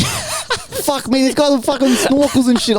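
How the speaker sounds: high, laughing exasperation; cracking up at the snorkelers; laughing disbelief. Real, not acted.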